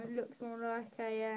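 ordinary talk

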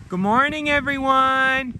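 high, loud sing-song greeting; calling to animals. Real, not acted.

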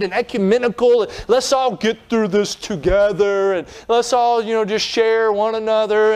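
high-pitched, hammed-up nagging; mock pleading reproach. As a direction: plead with him to just fit in. Acted scolding of the prophet Micaiah.

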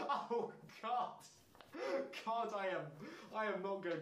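high, loud laughing oh god; laughing disbelief; laughing in horror. Real, not acted. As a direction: crack up in disgust.

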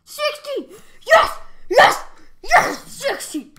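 high, loud yes-shouts; real victory whoops; triumphant cheering. Real, not acted. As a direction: celebrate the win out loud. Hits a high score.

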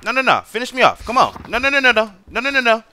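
high, frantic "no no no" as he's being killed; frantic pleading. Real, not acted. Gamer being killed in-game, begging in high frantic panic.

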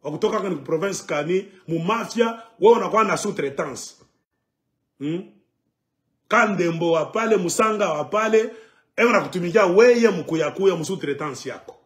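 ordinary talk

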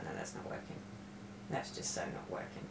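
whispered refusal. Retold or imitated. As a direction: whisper it, flat and done. Her own retold flat refusal.

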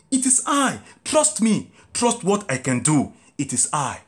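ordinary delivery

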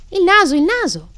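high, loud excited cry; loud burst of discovery; excited exclamation. A narrator's reading. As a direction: exclaim it like a thrilled little girl. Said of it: "esclamò Alice".

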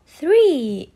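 three said loud and drawn out, falling; stressed three. Shown as a demonstration. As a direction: stretch out three, clear and falling. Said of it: "Repeat after me".

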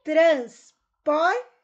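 "transporte" broken up for dictation; syllable by syllable. Shown as a demonstration. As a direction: say "transporte" loudly in three separate syllables. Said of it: "Separe as sílabas das palavras".

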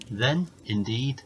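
ordinary delivery, nothing marked

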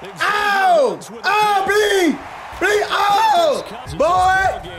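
high, pleading shouts building to yells; excited goading. Real, not acted. Gamer daring opponent to please throw it, high excited shouting.

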